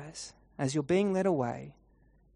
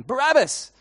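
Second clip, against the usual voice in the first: high, loud yell from the crowd; sudden loud call, pitched high; yelled name; 9 semitones higher, 8 dB louder than usual. Acted. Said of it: "yells out your name".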